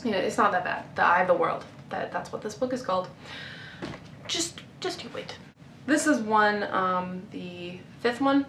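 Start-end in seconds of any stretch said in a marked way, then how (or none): 4.3-5.4 whispered build-up; teasing whisper. Real, not acted. Teasing the viewers before showing the worst cover, whispered. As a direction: hush it like a tease.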